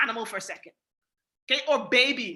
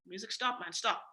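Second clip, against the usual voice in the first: much softer and lower, half-hearted; soft pleading; 6 semitones lower, 8 dB quieter than usual. Shown as a demonstration. Telling the dog to stop, soft and lax. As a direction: ask gently with no force. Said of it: "I'm not saying it aggressively".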